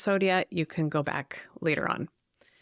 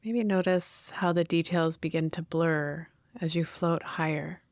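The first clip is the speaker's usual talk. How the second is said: guided meditation. Real, not acted. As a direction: gently guide listeners inward, leaving space to breathe.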